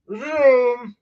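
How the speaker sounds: imitated race car zooming past; high, loud drawn-out engine "vroom". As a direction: make a cartoon engine noise. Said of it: "cars going".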